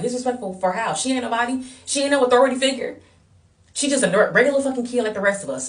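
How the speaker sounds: high, heated comeback; acted-out scoffing defiance; indignant retort.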